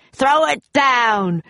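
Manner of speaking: slow, loud, high command; pirate cry. A narrator's reading. Captain Bones wants the medallion, crying out. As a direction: cry it out greedily, drawing it out. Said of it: "cried Captain Bones".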